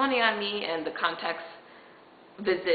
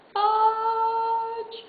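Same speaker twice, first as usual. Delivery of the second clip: very high, long-drawn mock wail; comic dismay. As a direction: stretch the swear substitute into a high squeal. Retold dismay at paying now.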